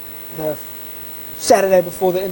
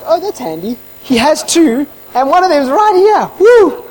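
high excitement building to a loud whoop; hammed-up glee; delighted relief; 10 semitones higher, 6 dB louder than usual. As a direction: celebrate a lucky find, climbing higher and louder. Finds the other assistant by luck.

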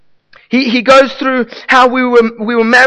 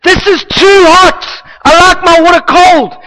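fussy husband complaint; 9 semitones higher, 5 dB louder than usual. Acted. Preacher mocks the law as husband, loud and fussy. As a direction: whine like a spoiled husband.

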